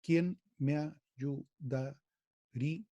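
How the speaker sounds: "¿Quién me ayudaría?" said slowly, each of the six beats set apart; beats spelled out. Shown as a demonstration. Teacher to poetry class, slowly counting the six beats. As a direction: tap out every beat of "¿Quién me ayudaría?" slowly. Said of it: "Tiene seis golpes".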